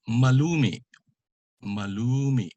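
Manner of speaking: MALUMI with long LU and a catch after MI; glottal stop. Shown as a demonstration. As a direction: stretch LU, cut MI off in the throat in MALUMI. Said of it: "it has a glottal stop".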